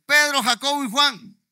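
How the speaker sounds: ordinary talk, nothing marked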